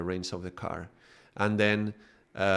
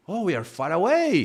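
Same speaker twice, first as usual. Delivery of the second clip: higher, louder dismayed realization; surprised soul; 8 semitones higher, 5 dB louder than usual. Retold or imitated. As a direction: notice you're lost and react. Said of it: "he says".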